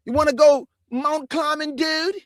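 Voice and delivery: high-pitched parody suburban buddy voice. Shown as a demonstration.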